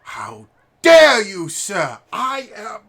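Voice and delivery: high, loud, spluttering aristocratic outrage; huge huff. Acted. Posh boy after the missed glove swing, loud high huff. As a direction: choke on your own indignation. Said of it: "in this huge huff".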